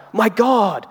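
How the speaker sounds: high startled "My God"; shocked exclamation. Retold or imitated. Someone shocked at work, a high startled cry. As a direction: blurt it out in surprise. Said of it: "or they'll cry out".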